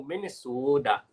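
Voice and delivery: slow mock Minnesotan accent; Minnesota accent. Shown as a demonstration. Showing how she says it, Minnesota accent. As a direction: stretch "Minnesota" out in the local accent. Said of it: "that's exactly what I say".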